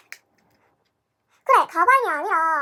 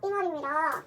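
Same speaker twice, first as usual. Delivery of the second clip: ordinary talk, nothing marked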